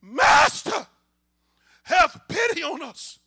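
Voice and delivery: high, loud plea for mercy; drawn-out pleading shout; crying out loudly. Acted. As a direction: call out to Jesus from afar, desperate. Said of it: "they called in a loud voice".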